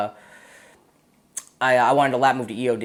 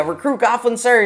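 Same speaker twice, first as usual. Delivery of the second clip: recruit sounding off; 10 semitones higher, 4 dB louder than usual. Retold or imitated. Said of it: "I'm like".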